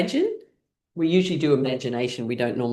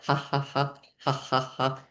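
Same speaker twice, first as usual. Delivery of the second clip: little laugh; 5 dB quieter than usual. Shown as a demonstration.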